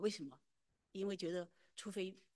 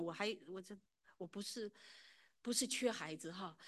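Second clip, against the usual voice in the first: usual speaking voice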